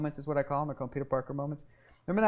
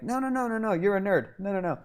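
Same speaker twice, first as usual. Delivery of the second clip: fate intervening; 5 semitones higher than usual. Retold or imitated. Fate voiced, cutting him off.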